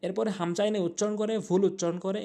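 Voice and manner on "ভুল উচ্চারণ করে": ordinary talk, nothing marked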